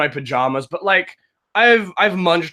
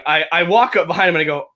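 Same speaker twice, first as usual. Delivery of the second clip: ordinary talk, nothing marked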